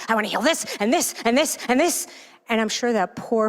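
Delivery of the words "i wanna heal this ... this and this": frantic list. Retold or imitated. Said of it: "I'm like".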